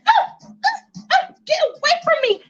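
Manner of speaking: very high panicked squeal at a mouse; scared shriek. Acted. Ming sees a mouse.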